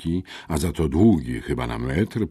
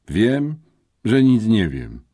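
groaning; 7 semitones higher, 6 dB louder than usual. Acted. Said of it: "jęknął maturzysta".

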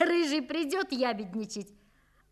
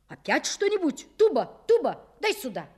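angry call; 4 semitones higher, 6 dB louder than usual. Acted. Father rushes at growling dog.

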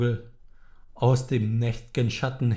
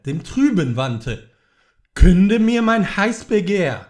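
higher, louder, rushing plea; desperate outcry; 5 semitones higher, 4 dB louder than usual. Acted. The grieving man cries out at the raven, high and loud. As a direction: fling the questions at the bird in despair.

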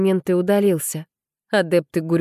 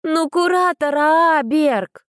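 high, slow, drawn-out outburst; blurted out; 10 semitones higher, much slower than usual. A narrator's reading. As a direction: blurt it out with a full breath. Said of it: "набрала в грудь побольше воздуха и выпалила".

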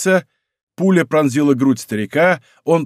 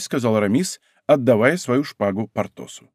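plain reading voice

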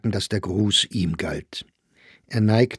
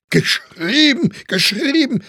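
scornful echo; 6 dB louder than usual. Acted. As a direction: throw the word back, stretched and derisive. Mocking echo in a quarrel. (schrill gedehntes, höhnisches Nachäffen)

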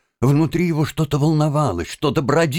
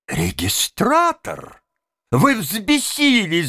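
angry cry; 5 semitones higher, slower than usual. A narrator's reading. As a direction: draw out each word in outrage. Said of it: "закричал рассерженный конректор". (сердитый протяжный окрик)

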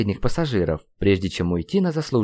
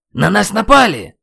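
loud, high alarm cry; shouting; 10 semitones higher, 8 dB louder than usual. A narrator's reading. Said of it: "закричал я, что есть сил". (тревожный крик)

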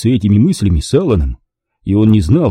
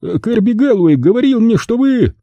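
dumbfounded; 13 semitones higher than usual. A narrator's reading. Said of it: "Вытаращив глаза, гангстер ответил".

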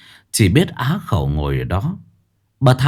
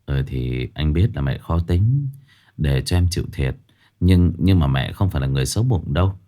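calm her down gently, in a lower voice; husband comforting his wife, low and soft; consoling husband; 5 semitones lower, 3 dB quieter than usual. Acted. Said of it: "Lục an ủi vợ".

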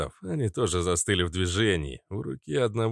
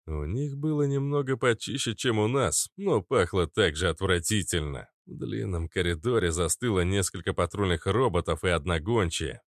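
ordinary delivery

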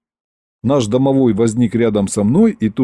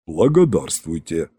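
low, slightly softer rumbling reply; lightly voiced rumbler, pitched down; басовитое гудение; 6 semitones lower, 3 dB quieter than usual. A narrator's reading. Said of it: "прогудел Иван". (негромко прогудеть низким голосом)

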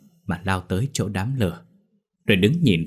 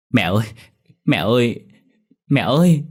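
plaintive calling that builds louder and higher; tearful wail; 3 semitones higher, 4 dB louder than usual. A narrator's reading. Dậu running home to mother, tearful calling. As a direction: call for mother, near tears. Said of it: "vừa chạy nó vừa mếu máo".

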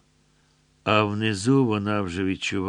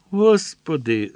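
cry out; 7 semitones higher, 6 dB louder than usual. A narrator's reading. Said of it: "зойкнула Руфина".

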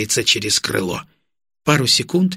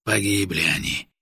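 sad; 4 semitones lower, 5 dB quieter than usual. A narrator's reading. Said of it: "Тяжело вздохнув".

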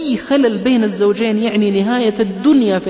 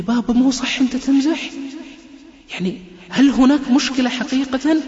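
put-on scared child; 7 semitones higher than usual. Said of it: "عمر بخوف شديد".

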